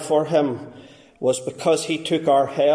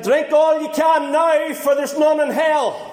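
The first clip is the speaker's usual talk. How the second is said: preacher shouting back; 10 semitones higher than usual. Retold or imitated. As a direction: call it out to the drunkards. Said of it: "he turns to them and he said".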